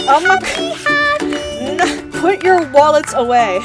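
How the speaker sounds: high, loud, drawn-out squeal; excited squeal. Real, not acted. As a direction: squeal in delighted shock.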